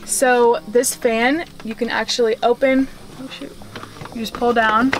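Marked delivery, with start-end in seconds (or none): none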